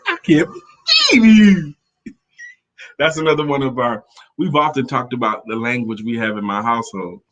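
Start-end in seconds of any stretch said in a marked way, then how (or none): 0.1-1.7 high, loud exasperated "I can't believe you"; a mock-indignant husband's stretched-out line; husband's catchphrase. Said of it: "That's how he said it".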